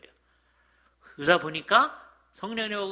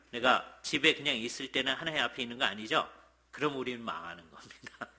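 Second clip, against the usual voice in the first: normal talking voice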